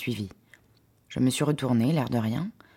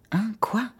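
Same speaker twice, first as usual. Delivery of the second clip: shocked; 9 semitones higher than usual. Acted. Shock at his offer.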